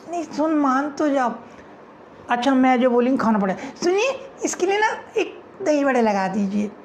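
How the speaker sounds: put-on high girlish whine; teasing girl friends; girls arguing. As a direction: coax a friend to eat.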